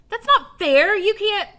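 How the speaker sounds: put-on high, loud indignant kid; outraged protest. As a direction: protest it hotly. Alan protesting loudly.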